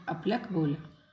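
usual speaking voice

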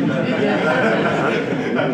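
amused laugh at his own pun. Real, not acted. Lecturer laughing at his amplitude hedonists pun. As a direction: laugh off the wordplay.

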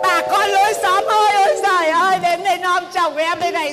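loud, high wail to the whole street; calling out to neighbours. Acted. Wife calling the neighbours to shame her husband, loud wailing call. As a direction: cry out for the neighbours to come see. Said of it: "Tôi la lên nhá".

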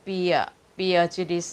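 "เปียจูดิส" with a "pia" opening; trying a pronunciation. Shown as a demonstration. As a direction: sound out "เปียจูดิส", testing the first syllable. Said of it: "ที่ออกเสียง".

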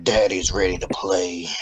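low, soft, creepy voice. Pretend. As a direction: make it sound sinister and low.

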